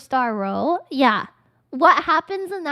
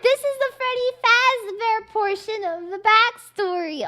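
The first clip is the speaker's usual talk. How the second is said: excited; 6 semitones higher than usual. Real, not acted. Excited announcement after squeal.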